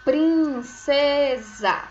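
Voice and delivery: princesa drawn out loud as prin-cé-za; teacher stretching each syllable of princesa. Shown as a demonstration. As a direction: sound out PRIN-CÉ-ZA very slowly. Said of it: "o S tem o som de Z".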